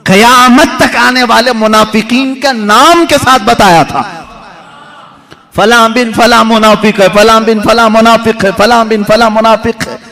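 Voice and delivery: each line of the list lifted and hammered out; emphatic preaching. Acted. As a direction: drive the list home to the listeners. Emphatic preaching of the hidden names. (ज़ोरदार, ऊँचे सुर में बयान)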